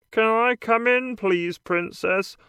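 lightly voiced frog character; a hint of a croaky frog. A narrator's reading. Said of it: "Then the frog says".

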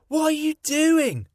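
put-on very high child voice; a small boy asking curiously; little boy Michael. A narrator's reading. Michael speaks.

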